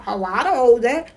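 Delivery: high, defensive protest; mock defensive denial. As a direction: play the indignant denier. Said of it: "It's not about".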